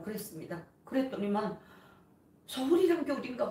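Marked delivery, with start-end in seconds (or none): 2.5-3.5 bewildered mother. Retold or imitated. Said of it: "고 하는 거예요".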